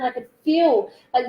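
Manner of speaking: ordinary delivery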